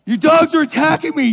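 high, loud protest; alarmed shout. Retold or imitated. Pastor shouting at the owner while being bitten, high and loud. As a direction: call out in disbelief at the owner. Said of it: "you know".

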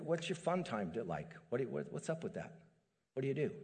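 ordinary delivery

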